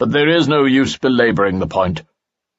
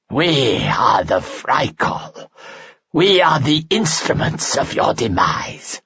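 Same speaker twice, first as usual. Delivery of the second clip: slow, higher rasping creature proclamation; monster voice; 5 semitones higher, slower than usual. Acted. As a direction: proclaim doom like a damned soul. Creature names its kind.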